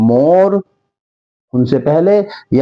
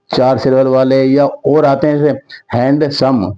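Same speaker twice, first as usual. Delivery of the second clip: ordinary talk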